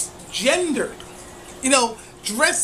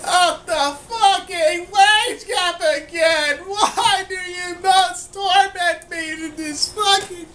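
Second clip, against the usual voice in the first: mock crying; 5 semitones higher than usual. Mock despair at wage gap.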